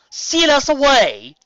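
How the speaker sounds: loud, high, slow alarm; horrified disbelief; shocked question. A narrator's reading. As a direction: recoil at the news. Shocked realisation.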